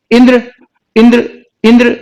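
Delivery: इंद्र with a quick short इ, repeated; short vowel. Shown as a demonstration. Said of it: "ई पे हम बहुत रुक नहीं रहे". (इंद्र में छोटी इ)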